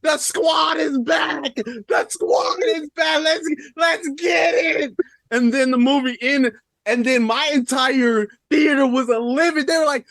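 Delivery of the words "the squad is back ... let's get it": high, slow cheering shout, voice cracking; ecstatic shout. Retold or imitated. Friend retelling the theater moment, ecstatic shout. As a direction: yell it like the heroes just returned.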